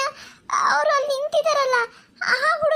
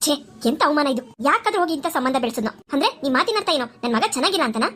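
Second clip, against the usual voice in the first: put-on lower, indignant older woman; an offended mother-in-law demanding answers; lower-voiced groom's mother taking offence; 9 semitones lower than usual.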